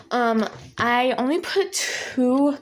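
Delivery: slow, stretched out words; a slow, drawn-out aside. Real, not acted. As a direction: drag the words out slowly. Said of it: "I don't know why I'm talking like this".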